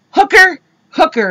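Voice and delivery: high, loud call; mimicked calling out. Boyfriend points out the pimp, mock calling out.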